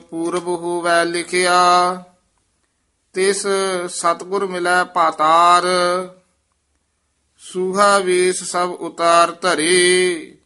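measured chant, held line endings; scripture recitation. Acted. Teacher leads students through Gurbani, devotional recitation. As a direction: recite the verse slowly on a near-level note.

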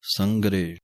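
ਸੰਗਰੇ with a clear ਗ sound; ਸੰਗਰੇ ਵਿੱਚ ਗ ਸਾਫ਼ ਬੋਲੋ; careful pronunciation. Shown as a demonstration. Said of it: "ਗਾ ਦੀ ਆਵਾਜ਼ ਦੇਣੀ ਹੈ".

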